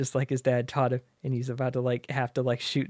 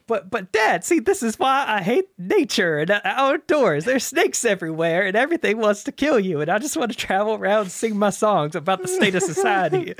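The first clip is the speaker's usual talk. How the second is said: higher, louder mock whine of a sulky teen; whiny son; 8 semitones higher, 6 dB louder than usual. Woody complains to his dad about nature, mock whining. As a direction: protest to dad like a moody kid.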